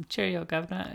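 put-on high, quiet stage-British accent. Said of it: "how they lost their accent".

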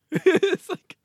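helpless laughter at the joke; laughing squeal. Real, not acted. Laughing about mascot's celebration.

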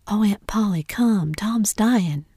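loud, alarmed call to an aunt; urgent cry for help. Acted. Sid runs to summon aunt.

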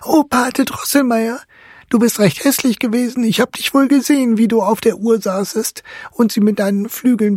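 put-on high-pitched child calling out accusingly; loud reproachful cry. A narrator's reading. Marie accuses her godfather from her sickbed, crying out high. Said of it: "rief sie laut dem Obergerichtsrat entgegen".

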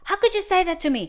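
high, loud indignant retort; imitating an offended woman snapping; mock angry lady. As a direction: throw the insult back at her. Retold angry lady quoted.

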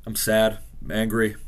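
normal talking voice

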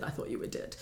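very soft, nearly voiceless; hushed retold quote. Retelling what her partner said after the crash, hushed. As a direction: drop to a grave hush as you quote him.

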